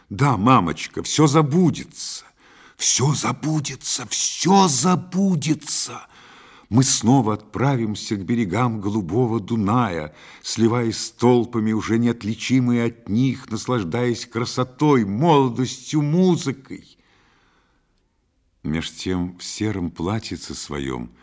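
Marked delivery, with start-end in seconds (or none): 0.1-6.1 high, building, drawn-out repetition; fervent reassurance. A narrator's reading. Son promising his mother forgetting, fervent. 6.7-17.0 high, swelling dreamy enthusiasm; rapturous. A narrator's reading. Son dreaming of the Danube with mother, rapturous.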